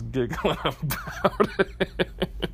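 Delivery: words dissolving into giggles; real giggling. Real, not acted. Friend recalls texting his buddy, real giggles breaking in. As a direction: start the line, then crack up slowly through it.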